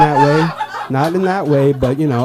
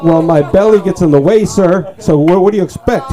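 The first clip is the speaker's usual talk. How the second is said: higher, louder indignant comeback; indignant retort; 8 semitones higher, 6 dB louder than usual. Acted. Runner answers the man mocking his belly, indignant. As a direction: talk back to him in protest. Talking back to sir in story.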